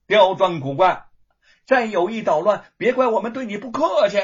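threatening shout. Acted. Gatekeeper threatens the young man.

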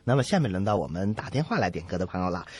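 normal talking voice

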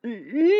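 high "mm" sounds with mouth covered; stifled, startled humming; muffled protesting moans. Acted. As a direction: struggle wordlessly against a sudden kiss. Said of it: "叶修竟然亲她".

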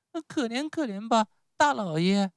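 put-on very high puppet-child voice; pleading Pinocchio. Pinocchio begs the showman for pity, high pleading. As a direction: beg for mercy in a piping voice.

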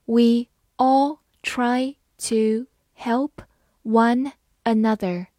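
slow, clear word by word reading. Shown as a demonstration. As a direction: read it slowly for learners. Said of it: "好，慢慢来读。". (慢速朗读)

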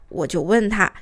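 ordinary talk, nothing marked

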